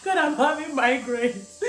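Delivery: laughing cry. Retold or imitated. Retelling labor screams.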